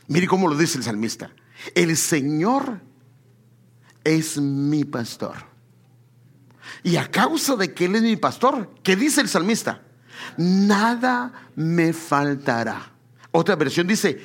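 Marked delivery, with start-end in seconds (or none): 1.7-12.9 drawn out, each phrase weighed; slow reverent reading; slow scripture reading. Acted. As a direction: recite the psalm slowly and solemnly. Slowly recites Psalm 23.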